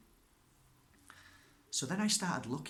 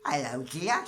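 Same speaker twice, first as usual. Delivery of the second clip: a lightly voiced warm old lady, louder; Mrs Singh's greeting; 2 semitones higher, 7 dB louder than usual. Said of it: "That's how she spoke".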